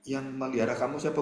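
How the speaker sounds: usual speaking voice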